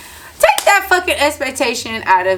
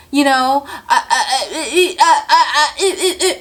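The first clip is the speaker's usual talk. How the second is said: higher, laughing through a mock stammer; 6 semitones higher, 6 dB louder than usual. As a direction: stutter it out like she's lost for words, giggling. Mocking coworker stammering for answer.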